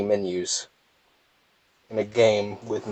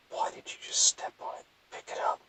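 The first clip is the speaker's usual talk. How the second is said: hushed muttering at the game; whispered grumble; 11 dB quieter than usual. Real, not acted. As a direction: whisper it under your breath.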